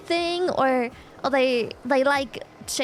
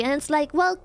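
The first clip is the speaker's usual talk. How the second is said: ordinary talk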